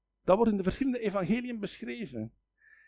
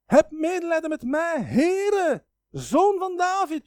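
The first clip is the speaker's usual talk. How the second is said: loud, high pleading cry, slowing at the end; 9 semitones higher, 8 dB louder, slower than usual. Retold or imitated. Canaanite woman cries to Jesus for mercy, loud high plea. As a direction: call out desperately for mercy. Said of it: "Die roept".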